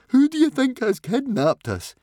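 very high, loud panicky question; shivering fear. A narrator's reading. Fishlegs to Hiccup about kidnappers, high shivery panic. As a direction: ask it in a high, frightened squeal. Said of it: "shivered Fishlegs".

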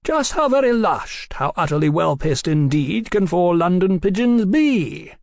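high, lofty taunting question; florid, condescending call; elaborate, mocking wit. A narrator's reading. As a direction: pose it grandly to inferiors. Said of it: "casting pearls of wit before swine".

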